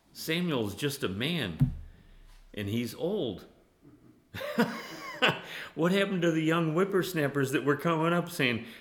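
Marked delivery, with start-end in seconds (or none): 4.3-5.7 high-pitched burst of laughter. Real, not acted. Preacher after calling Samuel old, laughing. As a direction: crack up at your own line.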